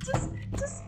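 panicked. Real, not acted.

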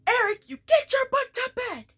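high, loud mock yell from an angry mom. Mom yelling at Eric to get to bed, loud and high. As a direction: shriek it like a fed-up mother. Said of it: "Then she's like".

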